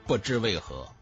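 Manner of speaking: ordinary delivery, nothing marked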